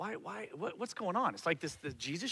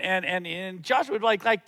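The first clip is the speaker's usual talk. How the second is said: usual speaking voice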